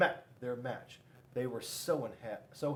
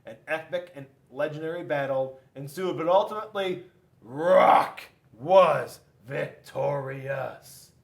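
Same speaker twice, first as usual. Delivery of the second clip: dramatic announcement; 10 dB louder than usual. A narrator's reading. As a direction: stretch it out like a big victory. Dramatic narration climax.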